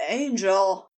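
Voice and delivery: low, breath-caught moan. A narrator's reading. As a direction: let the word fall out as a moan.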